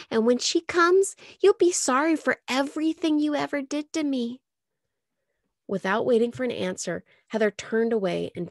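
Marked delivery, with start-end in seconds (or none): 0.1-4.4 threatening. Acted. Heather threatens Molly and Michael with Helen, high and menacing. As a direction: promise revenge.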